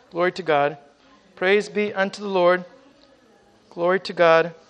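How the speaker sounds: ordinary delivery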